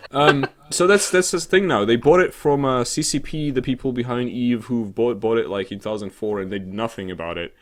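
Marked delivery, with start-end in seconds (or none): none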